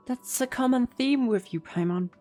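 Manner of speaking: ordinary talk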